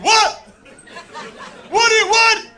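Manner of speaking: high, loud incredulous brother; mock offended older brother; brother's voice. Comic retelling, brother baffled by my offer.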